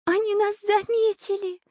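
put-on high girlish cry of horror; very high, frightened little girl; terrified cry. A narrator's reading. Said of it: "в ужасе закричала Настенька".

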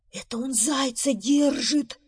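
high, strained, fearful voice; horrified stage whisper. Acted. Beaver peeking at bear's window, horrified. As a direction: gasp it out high in horror. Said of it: "в ужасе прошептал бобр".

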